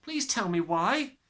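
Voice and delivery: high, slow, begging; desperate child's plea; pleading cry. A narrator's reading. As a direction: beg her to explain. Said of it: "Matilda cried".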